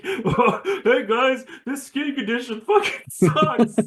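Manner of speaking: high, slow comic complaint through laughter; mock-pathetic high voice, half laughing; the Thing. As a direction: whine it in a hammed-up cartoon voice. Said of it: "now it's just like".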